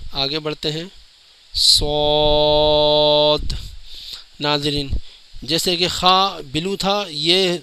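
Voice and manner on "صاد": صاد heavy and rounded, very long, ending in d; full and thick. Shown as a demonstration. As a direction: stretch a thick صاد slowly to its d. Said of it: "پر یعنی موٹا پڑھیں گے".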